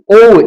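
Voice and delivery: ओ gliding into "ou"; American O. Shown as a demonstration. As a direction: round ओ off into a W. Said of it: "America में ओ का sound कैसे".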